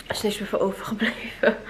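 soft self-pitying whisper. Real, not acted. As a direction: breathe it out as a whisper. Quiet aside about her face.

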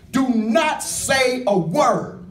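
slow, every word weighted; emphatic. Retold or imitated. Jesus to the two healed men, slow and emphatic. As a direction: lay down each word deliberately. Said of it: "he's emphatic".

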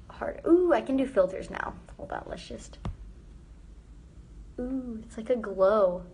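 ordinary delivery

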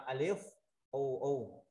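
"alif" rising, then "U U" as oo; spelled-out syllable reading. Shown as a demonstration. Teacher showing students how alif with mark U reads, sounding out. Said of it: "Mình sẽ đọc là".

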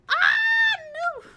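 very high, loud shriek; piercing panicked scream. Real, not acted. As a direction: scream it in horror. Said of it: "I'm gonna start screaming".

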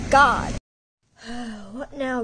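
ordinary talk, nothing marked

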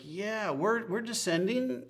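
high, goofy slurred imitation; town drunk. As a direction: mimic a confused, giddy pilot. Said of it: "he's like".

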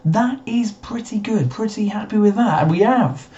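commentator voice. Acted. Mock commentator opening.

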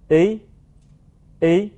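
A as French "é", lip corners drawn back; French closed "é". Shown as a demonstration. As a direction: say A between open "è" and "i", tongue tip down. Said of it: "嘴角要向后缩".

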